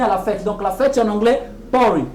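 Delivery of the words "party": usual speaking voice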